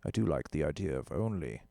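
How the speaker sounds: a hint of a vague toff accent, fading away; put-on posh accent. Poking fun at rich gear snobs, vague put-on accent. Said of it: "I don't know what accent that is".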